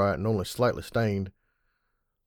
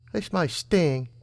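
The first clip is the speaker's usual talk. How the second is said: higher teasing filly voice; 6 semitones higher, much slower than usual. A narrator's reading. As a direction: tease him with a grin. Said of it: "a grin on her face".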